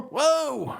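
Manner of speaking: high jolted whoa; startled whoa. Acted. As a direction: jump back in fright. Mater frightened.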